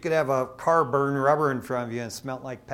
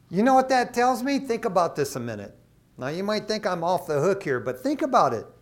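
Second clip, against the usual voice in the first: usual speaking voice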